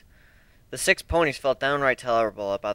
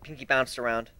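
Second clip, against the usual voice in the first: usual speaking voice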